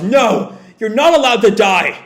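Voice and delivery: high, loud commanding yell; desperate yell. Acted. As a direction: yell an order at a dying friend. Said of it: "Shiki yells".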